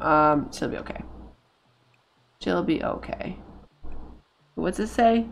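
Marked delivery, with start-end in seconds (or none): 0.5-1.0 whispered, unvoiced reassurance; whispering to herself. Real, not acted. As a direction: breathe it out as a whisper.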